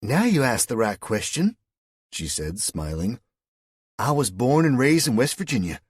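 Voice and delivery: high, loud West Virginia lilt; Southern woman amused at a foreigner. A narrator's reading.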